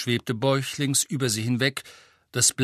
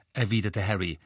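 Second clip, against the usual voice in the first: plain reading voice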